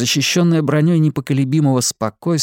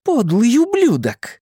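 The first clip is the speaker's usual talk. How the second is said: furious; 12 semitones higher, slower than usual. Acted. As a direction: spit the insult in rage. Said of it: "Её лицо покраснело от гнева".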